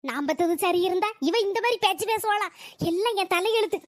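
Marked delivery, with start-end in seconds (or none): none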